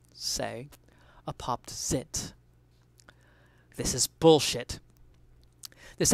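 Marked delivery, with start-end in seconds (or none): 0.1-4.8 slow, hushed whisper; disgusted whisper. Acted. Describing mayo's look to listeners, slow disgusted whisper. Gross-out punchline.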